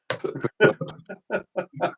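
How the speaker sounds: long, high-pitched laugh. Real, not acted. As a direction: crack up at your own joke. Joking about a secret bribe.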